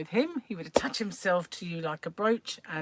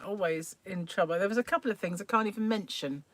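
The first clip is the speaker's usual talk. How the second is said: ordinary delivery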